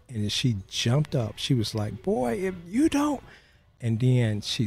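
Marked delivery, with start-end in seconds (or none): 2.0-3.2 high-pitched, slowed mother's warning cut short; mom's warning. Retold or imitated. Mother stopping herself mid-threat, slow and high. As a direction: slowly start a mother's threat, much higher. Mother about to scold son.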